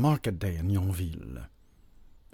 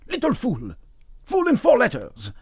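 high, loud rebuke; exasperated, high-pitched telling-off; angry scolding; 7 dB louder than usual. A narrator's reading. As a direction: berate the apprentice, building louder. Pharmacist scolds fainted apprentice.

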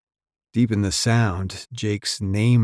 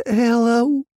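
shrill, high, breathless hello; shrill it out in panic; breathless fright; 6 dB louder than usual. A narrator's reading. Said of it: "the man shrilled".

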